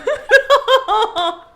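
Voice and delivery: shrieking burst of laughter; loud high laugh. Real, not acted. Laughs after comment.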